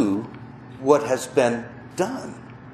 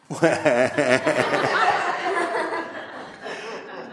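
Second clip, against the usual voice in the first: rueful laugh; 5 semitones higher than usual. Real, not acted. Tearful laugh recalling son's castle.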